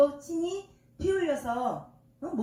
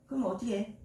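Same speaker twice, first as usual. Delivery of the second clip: ordinary talk, nothing marked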